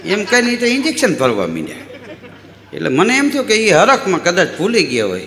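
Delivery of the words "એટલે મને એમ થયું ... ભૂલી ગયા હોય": ordinary talk, nothing marked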